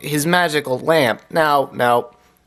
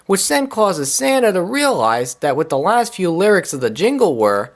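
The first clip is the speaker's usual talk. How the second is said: usual speaking voice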